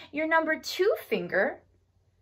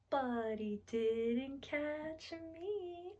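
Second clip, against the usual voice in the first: soft, very slow singsong punchline; playful gloating; 11 dB quieter, much slower than usual. Acted. Telling children the turtle missed her, playful gloating. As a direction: stretch each word out softly.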